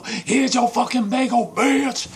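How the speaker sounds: high, loud yell while attacking; furious shout. Acted. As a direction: bellow it at a beaten opponent, hammed up. Attacker yells in acted fight.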